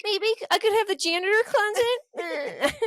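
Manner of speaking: high, whiny mock cry; mock whine. Friend whining about a janitor closet office, mock crying. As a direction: whimper it like a hard-done-by kid. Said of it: "I'd be like".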